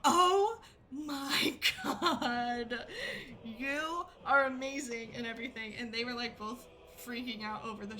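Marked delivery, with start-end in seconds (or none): 0.0-5.1 high, slow, half-laughing gush; excited squeal. Retold or imitated. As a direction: gush over the shirt like a thrilled fan. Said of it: "she's like".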